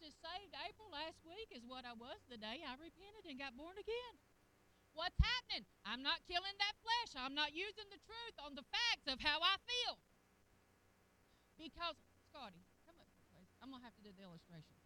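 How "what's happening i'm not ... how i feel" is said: loud, emphatic preacher's delivery; forceful preaching. Real, not acted. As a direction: drive it home at full voice. Preacher confronting his own flesh.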